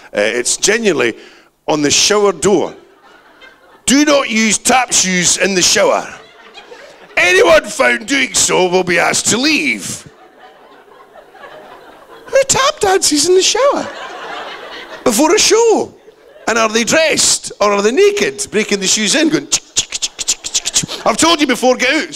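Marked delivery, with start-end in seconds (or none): 3.9-10.1 mock official. Reading funny sign aloud. 12.3-18.5 incredulous. Real, not acted. Incredulous comic questions. 19.5-21.1 tapping sounds. Retold or imitated. Imitated tap shoe sound. 21.1-22.1 mock scold. Acted scolding in shower.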